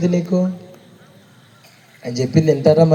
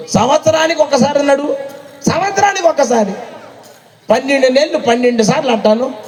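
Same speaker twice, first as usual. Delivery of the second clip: mock incredulous, higher; 8 semitones higher than usual. Mocking bargaining further.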